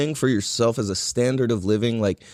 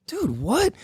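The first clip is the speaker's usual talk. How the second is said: high amazed dude what; 11 semitones higher than usual. Retold or imitated. Retelling his amazement at the sauna guy, high and amazed. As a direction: squeak it out in disbelief.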